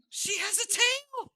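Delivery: very high, loud, slow squeal; delighted squeal. Acted. Fairy girl squealing at the creature's tail.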